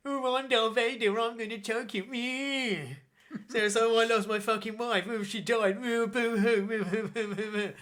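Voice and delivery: high, loud, whiny send-up; hammed-up mock blubbering, making him pathetic; Darth Vader. Admirals getting together to mock Vader, whiny. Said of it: "taking the piss out of Vader".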